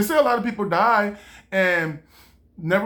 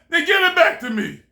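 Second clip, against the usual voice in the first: God taking it back; 10 semitones higher, 6 dB louder than usual. Retold or imitated. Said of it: "You're like".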